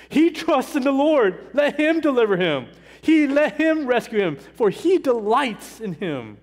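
high, loud sneering taunt; mocking sarcasm. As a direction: jeer at him like the scoffers at the cross. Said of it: "the sarcasm, the mockery".

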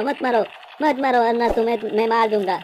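ordinary delivery

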